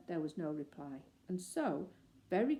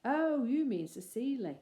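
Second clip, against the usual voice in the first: higher, louder working-man voice; a chirpy scene hand; lightly voiced stagehand answering the bear; 6 semitones higher, 6 dB louder than usual.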